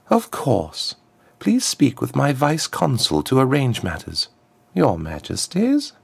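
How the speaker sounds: high, smooth voice of a formal diplomat; a smooth ambassador, pitched well up; smooth contralto ambassador. A narrator's reading. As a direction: address royalty smoothly in a high-set voice. Plays the ambassador.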